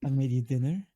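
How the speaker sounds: lightly voiced coy housewife; anime wife greeting. Housewife welcoming husband home, lightly coy. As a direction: tease it sweetly.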